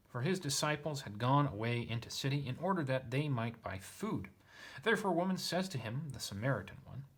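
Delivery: lifted, emphatic reading voice; expressive reading of a literal translation; scripture read aloud. A narrator's reading. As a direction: declaim the verse with swooping stresses. Reads the passage aloud.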